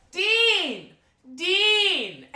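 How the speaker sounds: high-pitched holler, one name at a time; drunken calling out. Retold or imitated. Drunk girl on a bench calls the working host, high insistent holler.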